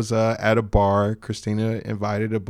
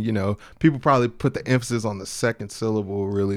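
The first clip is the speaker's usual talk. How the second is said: ordinary talk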